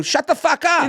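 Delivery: high-pitched indignant mock yell; retold yelling. Man breaking off a kiss to scold her, mock yell. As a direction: act out snapping at a heckler. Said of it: "when you're yelling at her".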